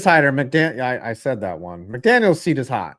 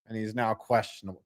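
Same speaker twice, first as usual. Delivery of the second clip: ordinary delivery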